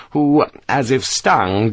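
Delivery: usual speaking voice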